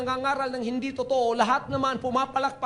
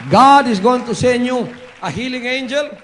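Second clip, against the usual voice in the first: loud declaration; 10 dB louder than usual. Real, not acted. Preacher declares loudly.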